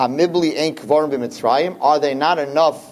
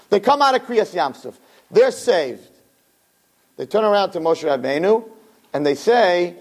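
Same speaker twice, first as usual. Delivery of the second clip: ordinary talk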